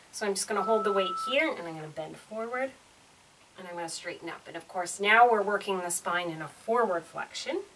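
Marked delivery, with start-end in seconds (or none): none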